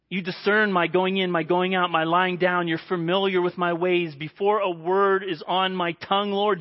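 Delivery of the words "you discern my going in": usual speaking voice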